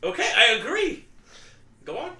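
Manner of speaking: usual speaking voice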